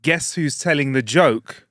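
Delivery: slow announcer title; segment announcement. Acted. Host announcing segment title, slow showman read. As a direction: announce it like a game show.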